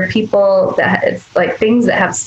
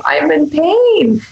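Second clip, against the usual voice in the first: voicing the body's pain; 8 semitones higher, much slower than usual. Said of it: "screaming at us and going".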